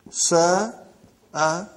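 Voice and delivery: Sa-a-la drawn apart with the hamza break; Sa-a-la split syllable by syllable; hamza demo. Shown as a demonstration. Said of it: "I'm writing Hamza".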